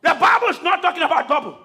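shouted sermon, rising with the crowd; fiery preaching. Acted. Preacher shouting to congregation, full force.

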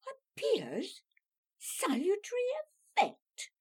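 "Appears" and "salutary" thrown back high and sharp; incredulous echo. Acted. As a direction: repeat "salutary effect" in indignant disbelief. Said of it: "Wickham glared at her".

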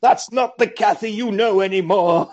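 hammed-up horror movie delivery; classic zombie-film line. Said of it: "the classic zombie line".

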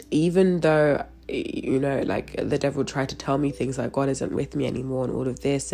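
ordinary talk

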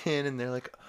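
ordinary delivery

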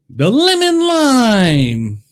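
slow, high, stretched-out flavor reveal; announcer reveal. Real, not acted. Vlogger announcing the lemon lime flavor to viewers, slow high announcer voice. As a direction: roll it out like a game show host.

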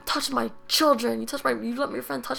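rising into a mock scream; mother's scream, imitated. Retelling mom screaming at dad during a fight, imitated high scream. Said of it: "she was screaming".

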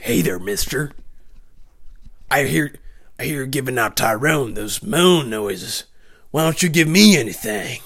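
a lightly voiced leering prisoner; hint of a low, sneering convict; creepy inmate. Menacing inmate Mister Wallace speaks.

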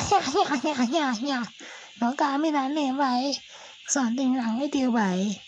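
put-on high Guyanese-accented scolding; high-pitched mock Guyanese woman scolding; Guyanese lady impression. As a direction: play the offended Guyanese coworker. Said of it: "She's a Guyanese".

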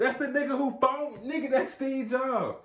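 high-pitched, exasperated explaining; offended disbelief. Retold or imitated. Fan telling guest who the picture shows, offended disbelief. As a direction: squeal the answer in mock outrage. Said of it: "Y'all know how offended I was?".

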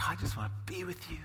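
soft, quick whisper of sympathy; tender whisper. Retold or imitated. Feeler whispering comfort to a hurting friend. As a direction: hush it like comforting someone in pain. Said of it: "Some of us are real feelers".

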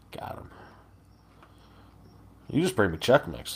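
usual speaking voice

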